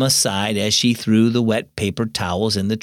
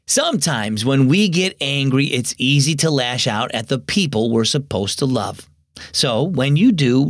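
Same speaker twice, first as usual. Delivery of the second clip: plain reading voice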